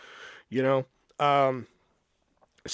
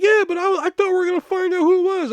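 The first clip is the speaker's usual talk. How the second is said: high, loud, fast whiny customer; high whiny protest; 8 dB louder than usual. Retold or imitated. Customer whining at the clerk, high and loud. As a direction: squeal it like a let-down kid.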